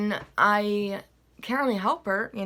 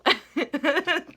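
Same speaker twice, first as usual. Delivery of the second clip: amused high laugh; 7 semitones higher, 4 dB louder than usual. Real, not acted. Laughing at own voice.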